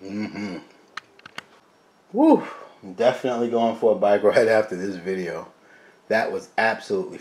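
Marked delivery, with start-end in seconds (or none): 2.1-2.4 excited whoop. Real, not acted. Cheer after tasting food.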